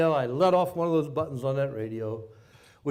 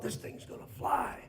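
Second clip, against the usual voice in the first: whispered thought; 8 semitones higher, 9 dB quieter than usual. Retold or imitated. Pilot's inner thought during dive.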